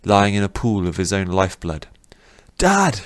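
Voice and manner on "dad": much higher, loud call of Dad; cry out. Acted. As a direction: shout for him in horror. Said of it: "Rin cried".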